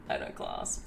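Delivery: mock posh voice. Mock Englishman claim.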